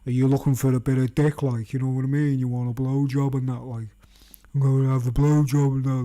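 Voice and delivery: a hint of a leering stranger; suggestive stranger voice. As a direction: spell out the hidden offer. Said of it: "their little code word for".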